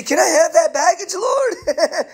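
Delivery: high, mock-whiny plea to the Lord; hammed-up begging with a laugh; mock pleading. Mocking a believer taking back burdens.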